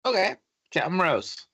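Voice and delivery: high put-on voice, wry; sceptical sailor. Crewman repeating the captain's fake name, wry. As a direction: say it like you don't quite buy it.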